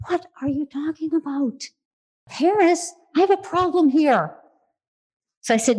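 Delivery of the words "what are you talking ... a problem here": higher, incredulous "what?" voice; baffled inner thought. Retold or imitated. Retelling her baffled reaction to the priest's question, incredulous.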